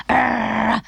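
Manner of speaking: rough mock dog bark. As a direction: bark like a frantic little dog. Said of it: "barked Dana".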